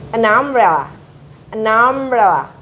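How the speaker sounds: An umbrella with an flowing straight into the vowel; linked an umbrella. Shown as a demonstration. Teacher drilling an before a vowel, clear. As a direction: join an to umbrella smoothly. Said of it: "harus memakai en".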